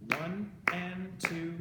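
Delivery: loud, very slow syncopated counting; slow demonstration count. Shown as a demonstration. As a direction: count out firmly over claps, holding each tied "and". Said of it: "demonstrate slowly how to do this".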